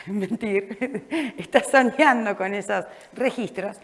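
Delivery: words broken by a real laugh; chuckling through a joke about a coworker. Real, not acted. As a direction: tease a colleague while laughing. Teasing a colleague in the room.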